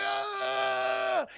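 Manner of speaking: long, high mock scream; drawn-out cry fading into a pit; falling scream. Max falls into hell.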